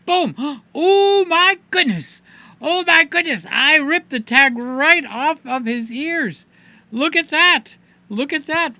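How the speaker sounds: high, loud play-acted horror; mock shock. Host pretending to Hoss the toy tag ripped, mock shock. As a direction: gasp and cry out in exaggerated dismay.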